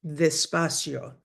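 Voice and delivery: "Despacio" with the D softened almost to "th", a bit slow; teacher modelling the soft Spanish D; soft D demo. Shown as a demonstration. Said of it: "The D sound is a the".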